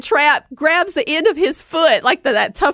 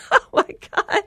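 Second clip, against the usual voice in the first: high breathless laugh. Real, not acted. Laughing at memory.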